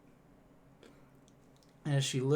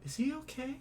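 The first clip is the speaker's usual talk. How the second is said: much higher, squeaky character voice; Vary asking about the kobold, high character voice; Vary's voice; 13 semitones higher than usual. Acted. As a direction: pitch the drow way up. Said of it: "Vary, the portly drow, looks over and says".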